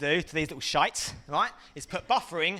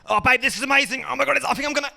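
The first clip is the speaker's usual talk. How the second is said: higher, louder hammed-up bedroom voice; mock passion; 7 semitones higher, 5 dB louder, faster than usual. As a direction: ham up the moment before buffering. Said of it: "in bed".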